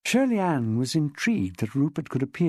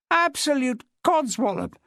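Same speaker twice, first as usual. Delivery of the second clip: much higher, scoffing dismissal; scornful; 9 semitones higher than usual. Acted. Rupert scornful outburst.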